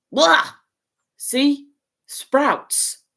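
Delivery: loud, high yuck of disgust. A narrator's reading. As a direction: spit out the taste. Ron bites a bad bean.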